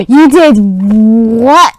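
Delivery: loud, drawn-out disbelief; super angry reaction. Acted. Giant told they killed him, slow loud outrage. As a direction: stretch it out in acted outrage. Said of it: "got super angry".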